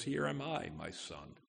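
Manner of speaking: quietly imitating a father near tears; soft, sinking to very low on "my son"; holding back tears. Abraham answering Isaac on the way to the sacrifice, subdued, holding back tears. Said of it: "fights back the tears".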